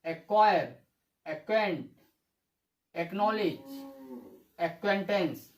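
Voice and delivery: "एक्वायर, एक्वेंट" with a single k sound, C unvoiced; silent C. Shown as a demonstration. Teacher reading examples of the silent C rule, silent C. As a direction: read "एक्नॉलेज, एक्वेंटेंस" without a separate C. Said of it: "सी का उच्चारण नहीं करेंगे।".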